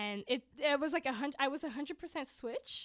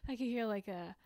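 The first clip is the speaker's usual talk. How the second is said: ordinary delivery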